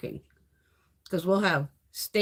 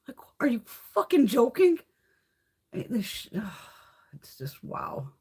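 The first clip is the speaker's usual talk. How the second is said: whispered, incredulous; whispered disbelief; 3 semitones higher than usual. Real, not acted. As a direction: hiss it under your breath in disbelief. Exasperated aside.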